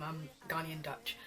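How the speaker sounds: ordinary talk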